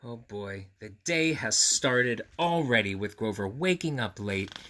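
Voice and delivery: plain reading voice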